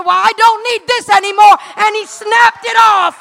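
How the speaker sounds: loud, high, triumphant shouting; full-force preaching. Real, not acted. Preacher telling how dad snapped off the priestly thread, shouted preaching. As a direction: proclaim it at full voice.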